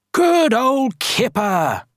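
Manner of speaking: high, slow, stretched cheer; calling out. A narrator's reading. Everyone praising Kipper for moving the stone, calling out. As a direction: call it out across the village. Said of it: "everyone called".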